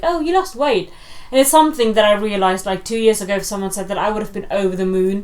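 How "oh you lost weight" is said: mock aunt. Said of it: "and it's like".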